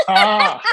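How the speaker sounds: loud, high laugh; big high cackling laugh; burst of laughter. Real, not acted. Laughs at Amy's reply.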